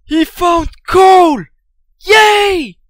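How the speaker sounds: high, slow, loud cheer; excited cheer. Pretend. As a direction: cheer it out like a kid. Cheering over found coal.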